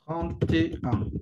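"trente et un" muy lento, sílaba por sílaba; slow demo pronunciation. Shown as a demonstration. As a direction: separa trente, et y un despacio. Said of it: "la prononciation trente et un". (muy despacio, como modelo)

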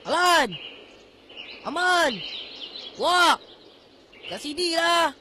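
calling out. Acted. Lost child calls friends over, shouting. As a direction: shout so distant friends hear you.

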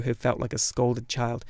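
usual speaking voice

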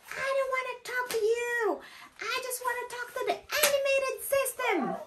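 very high, exaggerated irate whine; imitated angry customer. Customer refusing to talk to the agent, mock angry. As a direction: mimic a frustrated caller, far up in pitch. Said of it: "so, so angry because she just wanted".

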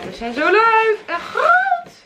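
high, delighted squeal; excited. Real, not acted. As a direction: gush over how big they are. Unboxing big plates, delighted.

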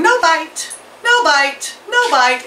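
high, chirpy parrot chant of the words. Retold or imitated. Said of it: "and it's going".

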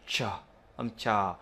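"chờ" as a crisp English CH sound. Shown as a demonstration. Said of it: "thì phải phát âm là". (phát âm rõ "chờ" như ch tiếng Anh)

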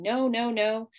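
mild mock scream of refusal; half-voiced panicked no; inner scream. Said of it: "in my mind I was screaming".

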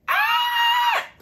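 very high scared shriek; startled scream. Retold or imitated. Comedian imitating his own frightened scream. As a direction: shriek like you got a fright. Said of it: "ビビった時の".

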